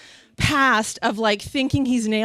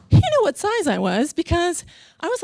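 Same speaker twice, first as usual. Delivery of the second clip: ordinary talk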